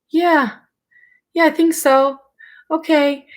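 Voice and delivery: high, hesitant yeah; unconvinced agreement. Retold or imitated. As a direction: reluctantly go along with it. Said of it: "And I'm like".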